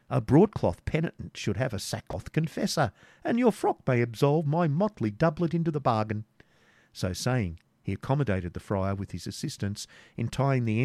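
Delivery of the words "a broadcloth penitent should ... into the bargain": lightly voiced jester in a higher pitch; quipping jester.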